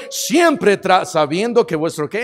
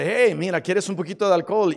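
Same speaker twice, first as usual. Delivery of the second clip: soft tempting devil; 7 dB quieter, faster than usual. Retold or imitated.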